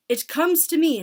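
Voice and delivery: put-on high, loud accented pronouncement; lofty witch addressing her followers. A narrator's reading. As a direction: declare it imperiously. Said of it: "said the Grand, Grand High Witch".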